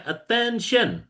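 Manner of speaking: high, slow call for attention; drawn-out classroom call; teacher command. Shown as a demonstration. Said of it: "Instruksinya masih sama ya".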